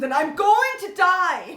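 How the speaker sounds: slow, loud, laughing despair. Real, not acted. Gushing to a friend about an actor, laughing slowly.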